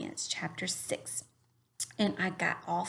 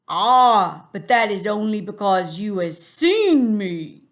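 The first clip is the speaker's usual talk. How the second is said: put-on a big friendly giant in a funny English accent, drawn out; 3 semitones higher, 12 dB louder, slower than usual. Teacher voicing the giant for her class, funny accent. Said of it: "give him a funny accent too".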